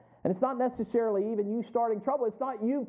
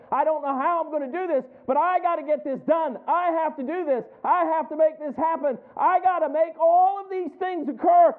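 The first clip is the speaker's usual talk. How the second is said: building to a higher, louder fluster; frantic self-talk; 6 semitones higher, 3 dB louder than usual. Acted. Preacher acting out a frantic, overloaded believer, rising fluster. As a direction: get more wound up with every line.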